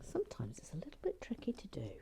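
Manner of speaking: fast soft whisper; whispered aside. Real, not acted. Whispering while fiddling with a tricky piece. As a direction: mutter it to yourself quickly.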